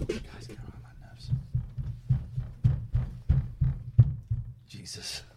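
unvoiced exasperated whisper. Real, not acted. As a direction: whisper it under your breath. Muttering after shouting at kids.

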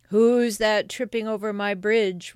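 loud, menacing troll challenge; troll's roar. Acted. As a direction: challenge the trespasser loudly as a troll. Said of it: "roared the troll".

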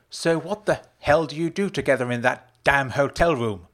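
loud, high angry demand; snapped. A narrator's reading. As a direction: snap the question out. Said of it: "Rose snapped".

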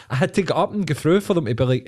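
ordinary talk

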